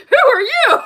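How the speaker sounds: high, loud startled shriek, laughing. Retold or imitated. Retelling a commercial, the housewife shrieks at the dragon in a startled voice. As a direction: shriek it like someone caught off guard. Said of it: "She's like".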